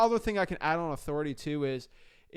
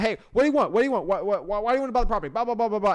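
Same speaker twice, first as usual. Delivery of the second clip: pushy, higher and louder, rapid-fire demands; impatient New Yorker; 5 semitones higher, 7 dB louder, faster than usual. Retold or imitated. Coach imitates impatient New York sellers demanding the point. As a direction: rattle it off like a brusque New Yorker. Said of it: "A lot of them, like, want to get to the point".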